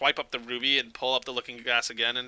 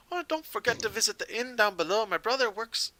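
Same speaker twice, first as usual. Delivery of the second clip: put-on high, lilting Irish accent, calling after customers; 10 semitones higher than usual. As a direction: call out in an improvised Irish accent. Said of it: "like, an Irish accent on that".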